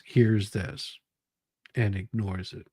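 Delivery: ordinary talk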